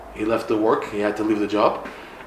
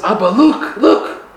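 excited; 8 semitones higher, 5 dB louder, slower than usual. Acted. Boy spots gold coins.

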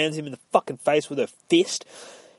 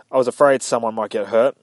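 usual speaking voice

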